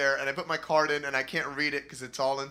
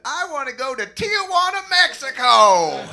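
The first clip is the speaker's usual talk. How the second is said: put-on a kid thrilled for his birthday; excited brother; 11 semitones higher, 13 dB louder than usual. Younger brother excitedly choosing Tijuana for his birthday, high and loud. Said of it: "And he said".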